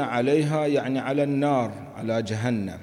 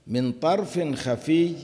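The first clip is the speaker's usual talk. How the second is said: higher, slow chanted phrase من طرف خفي; verse recitation; 6 semitones higher, slower than usual. Shown as a demonstration. Teacher quoting the phrase from the verse, chanted slowly.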